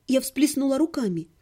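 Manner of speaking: ordinary delivery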